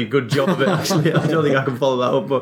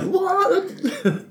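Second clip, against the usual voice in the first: a high squeaky "What?"; mock stunned fan at the late goal; shocked disbelief. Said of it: "you're like".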